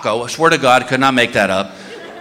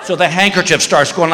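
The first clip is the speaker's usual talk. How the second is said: normal talking voice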